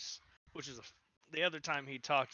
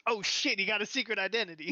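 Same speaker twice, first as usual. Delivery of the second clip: high, loud, quick mock amazement; astonished exclamation; 6 semitones higher, 4 dB louder than usual. Imitating Kevin Smith learning a secret, astonished. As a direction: blurt it out as a stunned onlooker.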